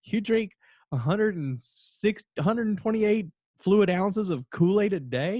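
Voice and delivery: high-pitched mock incredulity; hysterical disbelief. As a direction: imitate a friend cracking up in astonishment. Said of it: "he just starts laughing hysterically".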